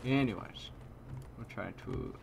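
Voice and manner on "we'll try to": whisper. Real, not acted. Unvoiced line while playing.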